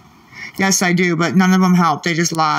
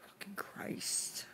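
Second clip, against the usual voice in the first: breathy whispered swear under her breath; whispered curse; 26 dB quieter, slower than usual. Real, not acted. Annoyed streamer whispering a curse at the chat. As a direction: let the curse slip out in a whisper.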